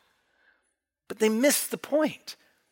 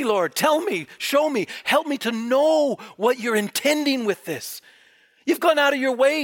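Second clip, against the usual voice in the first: excited prayer; 5 semitones higher than usual. Real, not acted. Excited preacher breaks into prayer.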